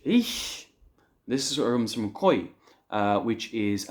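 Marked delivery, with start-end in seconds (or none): 0.0-0.7 "Eish" said high and sharp, like "aysh"; "Eish" pitched high as an exclamation; exclaiming eish. Shown as a demonstration. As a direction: exclaim "Eish" as one rising burst. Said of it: "E I S H".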